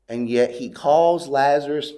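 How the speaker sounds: ordinary talk, nothing marked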